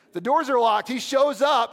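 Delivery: loud, high dramatic telling; storyteller hyping the sudden appearance; excited buildup. Acted. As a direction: build it up like a surprise reveal. Comic dramatic buildup of Jesus appearing.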